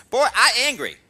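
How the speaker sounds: put-on loud, high, angry Korean accent; broken Korean-English. Korean master storming into taekwondo class, broken Korean-English. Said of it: "she talks sort of broken Korean".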